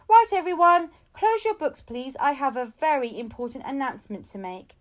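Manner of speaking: loud, high teacher call; teacher calling class. A narrator's reading. Missus Khan calls the class to order, loud. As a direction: grab the class's attention. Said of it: "clapping her hands, said".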